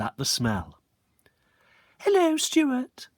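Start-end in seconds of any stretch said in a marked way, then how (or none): 2.0-3.1 put-on very high, slow prissy girl; high sneering schoolgirl hello; triplet girl's voice. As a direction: draw out the greeting with disdain. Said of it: "said the one with glasses".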